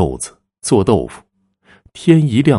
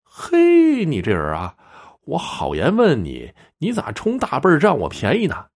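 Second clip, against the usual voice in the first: annoyed; 8 semitones higher than usual. A narrator's reading. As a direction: open on a high yelp, then protest crossly. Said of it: "刘庆生火了".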